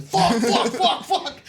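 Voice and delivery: high, loud panicked cursing, played for laughs; mock panic. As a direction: reenact a scramble for cover. Reenacts running from gunfire mid-piss.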